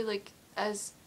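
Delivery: ordinary delivery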